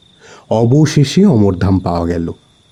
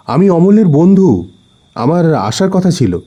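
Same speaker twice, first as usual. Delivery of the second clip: calling up; 6 semitones higher than usual. Acted. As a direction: call out to someone upstairs. Calling up to the balcony.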